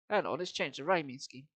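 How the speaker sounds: ordinary delivery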